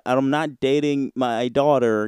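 ordinary talk, nothing marked

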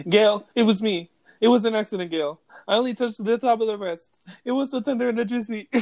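a hint of a Jamaican cameraman; light Jamaican accent. Cameraman confessing to Gail, light Jamaican accent. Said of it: "Jamaican or what ... his little accent".

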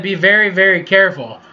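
normal talking voice

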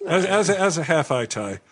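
usual speaking voice

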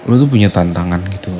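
ordinary talk

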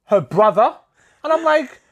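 high, loud, rushed retelling; blurting it out in a high voice; excited. Real, not acted.

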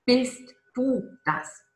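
"bist du das?" sehr langsam, jedes Wort einzeln gesetzt; "bist" betont, dann fallend und gedehnt. Shown as a demonstration. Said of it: "Es geht immer darum, bist du das?". ("bist du das?" ganz langsam und nachdrücklich fragen)

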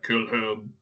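low, hushed imitation of a demon; spooky demon voice. Player imitating the game's main demon, weird spooky voice. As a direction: imitate a demon's yell at reduced volume. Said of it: "does the weird spooky voice and yells".

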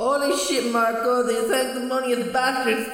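high loud disbelief; surprised. A narrator's reading. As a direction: react in shocked delight. Malachi shocked.

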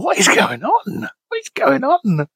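baffled, voice shooting up high; incredulous squeal. Real, not acted. Bizarre message makes him squeal.

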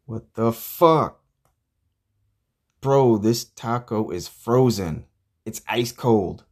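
loud, drawn-out outrage; indignant slow outburst; angry disbelief. Acted. Finds his taco is frozen.

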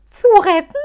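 high, very loud scornful echo; mocking echo. Halbschuh mockingly echoing Muff's words. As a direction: parrot his words back scornfully. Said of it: "äffte ihm Halbschuh nach".

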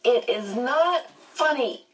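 high, huffy protest; indignant child. A narrator's reading. Charles objecting to the joke, in high-pitched childish indignation. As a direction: pitch it up like an offended small boy.

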